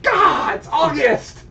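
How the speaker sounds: high, loud cry of disbelief; exasperated outburst. Retold or imitated. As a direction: shout it out, fed up. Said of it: "and I'm like".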